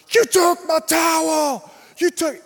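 high, slow, loud accusing yell; furious old fan. Retold or imitated. Angry fan to the boy, high mock yell. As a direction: spit it out as an outraged old man. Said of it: "he is furious".

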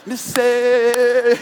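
high, loud drawn-out taunt; inner cheerleader. Retold or imitated. Preacher dialing into his inner cheerleader, loud high chant. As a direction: chant it like a piercing cheer squad. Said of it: "inner cheerleader".

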